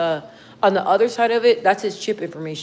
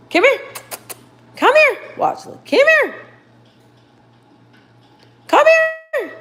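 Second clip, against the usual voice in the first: high sing-song call to the dog; 4 dB louder, slower than usual. Real, not acted. Owner calling her dog, high coaxing call. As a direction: coax the dog over, pitched way up.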